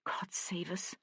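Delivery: a breathless whisper of dread; trembling whispered prayer; frightened whisper. A narrator's reading. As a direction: whisper it in fear. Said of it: "He felt her tremble".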